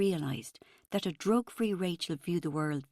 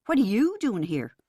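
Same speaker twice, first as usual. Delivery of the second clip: louder demanding retort; demanding back; 3 semitones higher, 7 dB louder than usual. A narrator's reading. Rachel throwing Helen's question back, demanding. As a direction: fire the question straight back. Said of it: "I demanded back".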